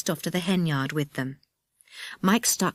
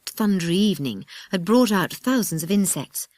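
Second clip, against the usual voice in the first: ordinary delivery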